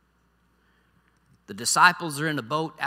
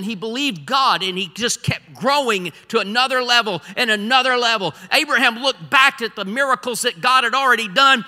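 loud, raised preacher's voice; impassioned preaching; 6 semitones higher, 8 dB louder than usual. Acted. Preacher recalling Abraham's growing faith, impassioned. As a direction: preach it out, driving and emphatic.

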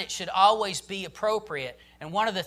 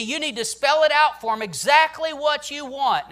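louder, higher, driving sermon voice; full-force preaching; 7 semitones higher, 8 dB louder than usual. Acted. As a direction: preach it hard to the parents.

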